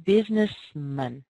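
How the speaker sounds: "Businessman" slow with a schwa, "mun"; reduced man. Shown as a demonstration. Teacher modelling the reduced ending. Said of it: "here's what they sound like".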